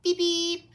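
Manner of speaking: high, loud toy-car beep; cheerful horn noise. Acted. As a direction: honk like a little car.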